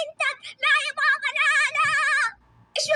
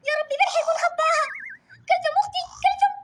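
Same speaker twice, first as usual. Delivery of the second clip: crying out; 6 semitones higher than usual. Acted. Child frantic about missing sister.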